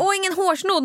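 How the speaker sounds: put-on high-pitched child calling for mum; imitated demanding child. Mother imitating child who lacks socks, high demanding call. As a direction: mimic a kid calling out a complaint.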